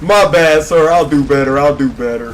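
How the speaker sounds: loud, hammed-up pleading promise; mock apology. Player apologizing after disappointing his granny, mock-earnest shouting. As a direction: blurt the apology loudly, coming off a laugh.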